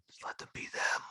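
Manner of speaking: breathy whispered plea, fed up; exasperated whisper. Real, not acted. Begging the bookers to drop accents, whispered. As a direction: sigh it out under your breath.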